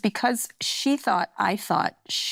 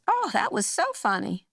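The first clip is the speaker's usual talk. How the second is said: higher, chirpy aunt; aunt's cheerful voice; 9 semitones higher than usual. Retold or imitated. Aunt from the kitchen, lightly voiced, cheerful. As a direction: sing it out like a pleased aunt. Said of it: "She goes".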